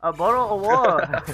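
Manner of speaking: loud, high mock English accent on bottle of water. Shown as a demonstration. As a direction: belt it out in a joke English accent. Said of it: "with an English accent".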